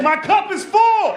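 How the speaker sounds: high, drawn-out loud shout; defiant shout. Acted. Shouts excuse back at neighbour.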